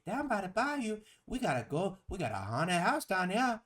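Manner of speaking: hammed-up New Orleans accent, stretched out at the end; Lena Dupree. She invites someone to the bayou, hammed-up New Orleans accent. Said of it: "so she got an accent".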